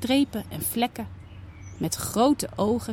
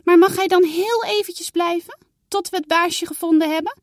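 put-on higher, louder pleading little girl; a child wheedling for a little longer; begging girl; 9 semitones higher, 6 dB louder than usual. Saar begging mama, higher pleading girl voice.

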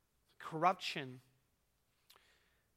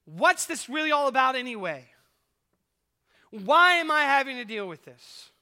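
higher and louder, scornful and dismissive; sarcastic; 5 semitones higher, 8 dB louder than usual. Retold or imitated. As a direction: toss it off with irritated sarcasm. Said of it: "It's more of a sarcastic way of saying".

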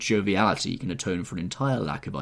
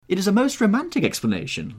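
very high, louder amused hostess; laughed; 13 semitones higher, 6 dB louder than usual. A narrator's reading. Hostess amused by her guest's witticism, laughing manner. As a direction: exclaim in high-pitched amusement. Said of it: "laughed the hostess".

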